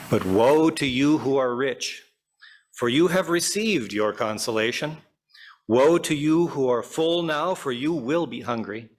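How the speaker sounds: ordinary delivery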